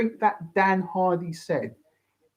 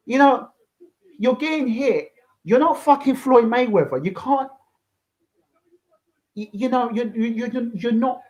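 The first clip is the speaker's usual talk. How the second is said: exasperated scolding; 6 semitones higher than usual. Real, not acted. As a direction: lecture him like a frustrated coach. Lecturing Conor directly, exasperated.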